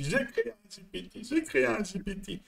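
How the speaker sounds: soft, thin mimicry of excited newcomers; mock excited crowd. Coach mimicking everyone getting carried away over GPTs, softly mocking.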